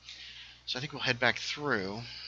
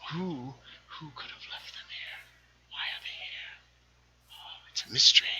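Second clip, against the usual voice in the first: mock-mysterious whisper; 5 semitones higher, 3 dB quieter than usual. Mock mystery about found dirt.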